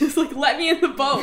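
loud, high retold yelling with a laugh nearby; half-amused furious pleading; acted exasperated anger. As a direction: shout the demand in mock frustration. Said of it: "he was so mad at her".

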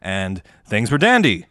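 normal talking voice

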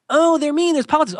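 high, loud whiny mockery of a griping coworker; mock whiny complaint. Mocking coworkers who blame office politics, high whine. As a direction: whine it like a petty excuse-maker.

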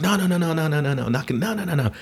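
high, rattled-off string of refusals; refusing kid. Retold or imitated. Kid guarding his birthday motorcycle from the brother, refusing.

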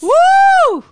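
very high, loud woo; cheering whoop. Real, not acted. Friend cheering on the wife, whooping. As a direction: whoop in support.

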